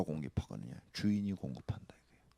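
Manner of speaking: soft unvoiced whisper; hushed reassurance. Real, not acted. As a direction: confide the promise quietly. Whispered sermon point.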